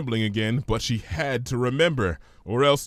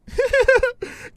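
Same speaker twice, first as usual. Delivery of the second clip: loud, much higher burst of laughter; high giggle; 6 dB louder than usual. Acted. Pinky laughing at Fluttershy's dark words, high giggle. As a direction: giggle it out brightly. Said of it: "shoulders bouncing with laughter".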